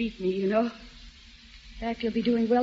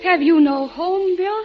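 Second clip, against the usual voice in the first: sharp demand; 7 semitones higher, 9 dB louder, slower than usual. Acted. Mary confronts Bill sharply.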